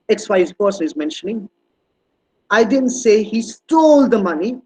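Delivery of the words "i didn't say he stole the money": "stole" drawn out long and loud; slow line with a swelling, emphatic "stole"; stress on STOLE. Shown as a demonstration. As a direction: lean hard on "stole", taking the sentence slowly. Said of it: "but it's not stealing".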